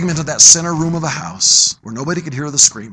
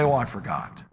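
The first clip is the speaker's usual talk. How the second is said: soft, much lower offhand shrug of a line; flippant excuse; 6 semitones lower, 11 dB quieter than usual. Retold or imitated. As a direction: toss it off lightly, not caring. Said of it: "and then flippantly you say".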